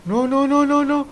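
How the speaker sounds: high, loud alarmed no's ending in a bang; real alarm at the crash; shouting no then mimicking impact; panicked no's. Real, not acted. Vehicle crash in game.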